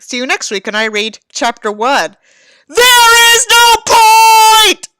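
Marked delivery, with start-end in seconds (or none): 2.7-4.9 high, slow, loud wail; over-the-top howl; mock dramatic yell. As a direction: scream it in mock despair. Parodic final outburst.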